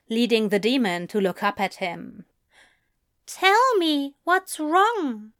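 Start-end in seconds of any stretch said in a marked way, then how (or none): 3.4-5.3 high, slow, firm demand; measured, high-pitched insistence. A narrator's reading. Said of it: "he said firmly".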